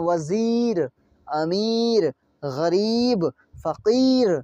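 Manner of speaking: long held ee in वज़ीर, अमीर, गरीब, फ़कीर; drawn-out vowel. Shown as a demonstration. Teacher listing examples for learners, ee drawn out. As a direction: stretch each ee before the last consonant. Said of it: "खींचते हैं".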